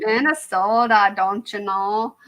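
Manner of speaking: drawn-out mock Minnesotan; Minnesota accent. Playing up own slip for fun, exaggerated Minnesota accent. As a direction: stretch the vowels like a Minnesotan. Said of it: "straight up from Minnesota".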